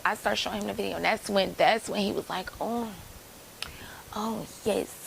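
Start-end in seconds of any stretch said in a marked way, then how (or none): 2.6-5.0 hushed, whispered delight; breathy whispered oh yes. Retold or imitated. Barber watching her video, whispering oh yes. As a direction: whisper it like a leering man.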